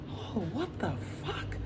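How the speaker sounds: very soft, under the breath; hushed aside of private disbelief; stunned inner thought. Retold or imitated. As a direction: think it aloud in disbelief, barely voiced. Retelling her silent stunned reaction.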